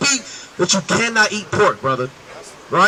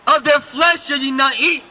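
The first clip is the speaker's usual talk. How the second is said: slow, higher reading of the verse; scripture reading; 4 semitones higher than usual. Acted. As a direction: read it out like a preacher. Reads scripture aloud.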